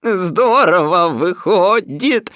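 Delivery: loud, high, drawn-out cheer; delighted shout. A narrator's reading. Donkey marvels at his balloon and pot, delighted shout. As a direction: shout it slowly with relish. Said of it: "закричал Иа-Иа".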